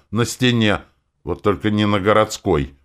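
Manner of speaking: bitter mimicking. A narrator's reading. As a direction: throw the words back bitterly. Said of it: "с горечью передразнил его я". (с горечью передразнивая)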